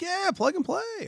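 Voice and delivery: high, slow, sing-song salesman voice; breezy salesman. Retold or imitated. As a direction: play him as a carefree, overconfident rep. Mocking the salesman's breezy promise.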